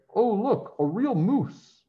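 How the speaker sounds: high, slow gasp of wonder; excited children. Acted. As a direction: point and marvel like a schoolkid.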